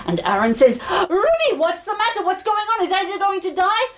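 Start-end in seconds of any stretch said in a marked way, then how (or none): 1.1-3.9 high, urgent string of questions; anxious pleading. Acted. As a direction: press the healer for answers, alarmed. Aaron panics over his bride.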